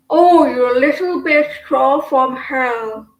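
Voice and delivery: loud quoted insult. Retold or imitated. Quoting the TV show line.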